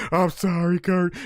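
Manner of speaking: mock crying Stone Cold. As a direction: blubber an apology. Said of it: "He's like".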